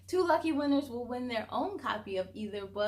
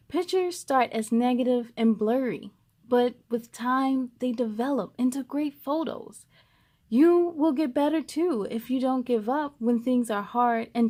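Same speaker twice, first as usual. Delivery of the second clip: lively read-aloud voice, a bit louder; storybook reading; 8 dB louder than usual. A narrator's reading. Reading a picture book aloud to young viewers, lively storytelling. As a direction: read it to a group of kids.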